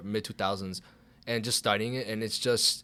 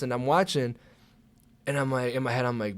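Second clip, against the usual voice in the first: ordinary delivery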